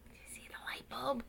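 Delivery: put-on quick whisper to the kids; whispered aside. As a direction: hush it like a secret. Reader shows picture to children.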